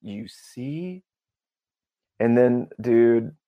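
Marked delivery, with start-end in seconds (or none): 0.0-1.0 very soft "you see"; hushed knowing reply. Retold or imitated. Acts knowing reply to healed man.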